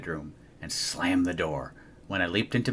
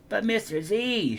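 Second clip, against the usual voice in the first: higher, pleading little whine; whining; 12 semitones higher than usual. Acted. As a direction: beg the teacher. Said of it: "she whined".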